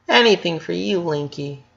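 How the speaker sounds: lightly voiced sweet girl; a hint of a doting sister; sweet little sister. A narrator's reading. Leni sweetly promising her brother anything.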